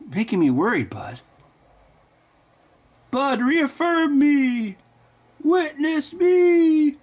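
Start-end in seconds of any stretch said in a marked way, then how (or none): 3.1-4.8 mock pleading. Begging his silent buddy. 5.4-7.0 mock battle cry. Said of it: "movie quote cry".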